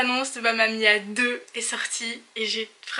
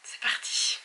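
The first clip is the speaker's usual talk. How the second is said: breathless whispered start; 9 dB quieter than usual. Real, not acted. Pressing play, whispering to viewers in suspense. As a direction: hush it like the lights just dimmed.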